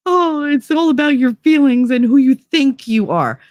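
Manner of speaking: high-pitched, gushing mock sing-song; exaggerated, derisive imitation, pitched well up; mocking mimicry. Mimicking those who teach feelings.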